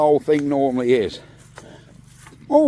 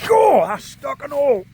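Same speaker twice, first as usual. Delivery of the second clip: startled shout; 9 semitones higher, 5 dB louder than usual. Real, not acted. Engine trouble, startled outburst.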